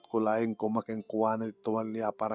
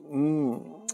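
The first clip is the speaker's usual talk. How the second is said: usual speaking voice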